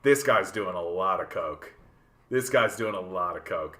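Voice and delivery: low deadpan aside to oneself; low, measured quoted thought; quoted first impression. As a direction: drop the pitch low, as a private verdict. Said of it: "I was just like".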